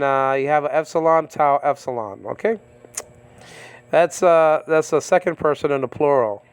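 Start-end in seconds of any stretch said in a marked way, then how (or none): none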